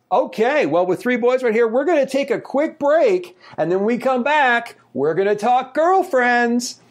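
talk-show host. Acted. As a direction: tease the next segment, drawing out the last words.

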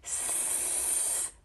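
soft, long voiceless sss; hissed S sound. Shown as a demonstration. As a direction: draw out a quiet hiss. Sound shown for the snail.

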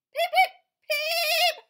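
very high, loud, slow laughing peeps; giggly duckling peeps. Acted. Reading to a child, duckling peeping with laughter. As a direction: peep through giggles. Baby duck in the bucket.

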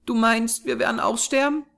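put-on high child's voice, questioning; wondering little girl. A narrator's reading. Small girl looking up after crying, wondering. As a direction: ask in a small girl's high voice. Child asks, tears stopped.

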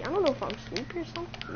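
soft, quick mumble; sleepy mumble. Real, not acted. Player telling friend he may be sleepy, quiet mumble. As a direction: rush it out under your breath.

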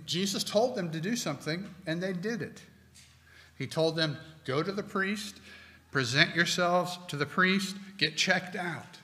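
ordinary delivery

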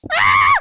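sudden loud yell of fright; shocked cry; startled scream. Real, not acted. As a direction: jump and scream. Sudden scare in the game.

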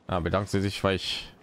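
usual speaking voice

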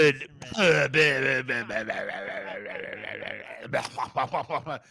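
long babbling, sputtering mouth noise; imitated garbled noise. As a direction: nonsense blubbering that stutters on and on. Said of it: "It was just noise".

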